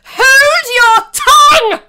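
slow, screaming command; furious, drawn-out shriek; enraged queen. A narrator's reading. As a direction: bellow it high, purple with rage. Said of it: "said the queen, turning purple".

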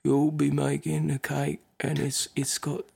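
quiet, slow, awkward Australian baker impression; no-charisma baker. Uncharismatic baker explains his cake challenge on TV, softly and listlessly. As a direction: mumble the challenge with zero showmanship. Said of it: "absolutely no charisma at all".

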